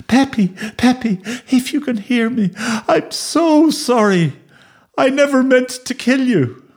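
high, plaintive wail; grieving apology. Acted. Boy apologising to his dead dog, wailing high. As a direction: beg forgiveness in a high, stricken voice.

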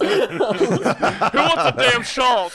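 high, loud and very slow through laughter; laughing, drawn-out shout. Real, not acted. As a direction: yell it while cracking up.

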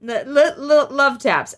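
laughing stammer. Real, not acted. Laughing while joking about damage.

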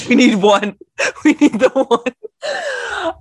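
stretched out through laughter, high and slow; giggling disbelief; laughing. Real, not acted. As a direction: laugh the words out slowly.

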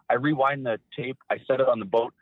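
ordinary talk

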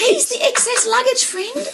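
high, half-laughing indignation; mock outrage pitched far above normal; upset protest. Passenger retelling her jab at the airport clerk, upset. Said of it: "I was so upset".

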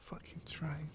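ordinary talk

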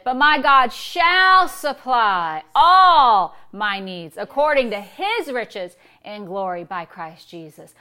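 SHALL and ALL held long and loud, then easing off; loud, drawn-out "shall", softening by "Jesus"; stress on SHALL and ALL. Shown as a demonstration. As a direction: declare it slowly, hammering "shall" and "all". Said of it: "we're changing the emphasis on every word".

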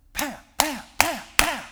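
loud, high smacking sounds, pam pam; imitated smacks. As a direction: slap out each spank.

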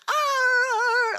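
sudden high, loud mock dog howl; playful growl howl. As a kid, howling to her collie: loud high mock howl. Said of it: "like that".

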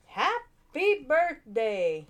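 very slow, drawn-out snowman voice; slow dazed snowman. A narrator's reading. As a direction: stretch it out like a waking snowman. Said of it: "said the snowman".

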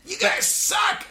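high, loud, drawn-out heckle; shrieky little heckler impression; imitated kid yelling. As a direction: yell it like a small kid from a loft. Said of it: "He kept yelling at them".

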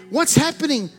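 ordinary talk

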